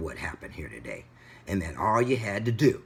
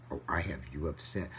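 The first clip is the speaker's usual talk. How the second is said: quiet sarcasm; 5 semitones lower, 8 dB quieter than usual. Retold or imitated. Sarcastic reply to the driver.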